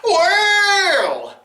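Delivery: high, loud delighted cry; excited shout. Acted. Whale sees the man.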